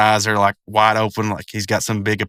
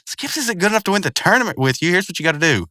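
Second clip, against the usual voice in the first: higher-pitched mock pleading; imitated upset, pitched up; upset cartoon character; 6 semitones higher than usual. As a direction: make an urgent, upset case. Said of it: "Rigby's upset, and he's like".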